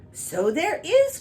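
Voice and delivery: high, loud, slow exclamation; courtiers crying out. Acted. Courtiers noticing the star, crying out high and loud. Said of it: "cried the courtiers".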